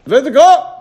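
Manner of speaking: loud, high cry of "Let it go!"; preacher urging listeners to release desires; exhortation. Real, not acted. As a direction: throw it out to the room.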